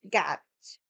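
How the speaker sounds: low, heavy Boston accent. Shown as a demonstration. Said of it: "Now my Boston accent's gonna come out".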